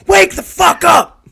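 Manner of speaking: a sudden high, loud mock yell. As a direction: blast it into the mic to jolt sleepers awake. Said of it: "I'm just gonna yell in the mic".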